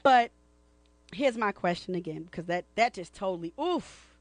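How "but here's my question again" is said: normal talking voice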